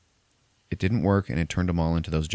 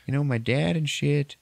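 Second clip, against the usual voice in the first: higher mock teen voice, brushing her off; lightly voiced boy, pitched up; imitating Jake; 8 semitones higher than usual. Retelling the movie to a friend, Jake refusing to stay, higher mock voice. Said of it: "And he's like".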